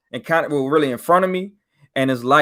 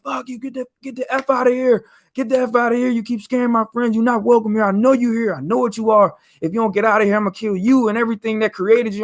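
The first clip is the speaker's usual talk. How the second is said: much higher, furious threats; snapping at a spirit; 9 semitones higher than usual. Retold or imitated. Dreamer yelling at the old lady spirit, much higher and furious. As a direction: drive the presence out with raging threats. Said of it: "I was snapping".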